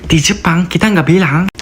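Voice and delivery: normal talking voice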